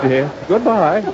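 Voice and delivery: ordinary delivery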